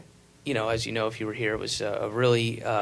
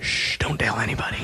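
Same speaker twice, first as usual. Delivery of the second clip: hushed whisper; 4 semitones lower, 5 dB quieter, faster than usual. Retold or imitated. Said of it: "whispering that".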